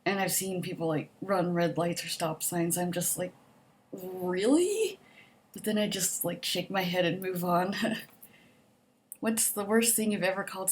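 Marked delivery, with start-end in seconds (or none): 3.9-5.0 drawn-out, rising incredulous question; mock disbelief. Reacting to drivers running red lights, incredulous. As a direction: act out judgemental disbelief.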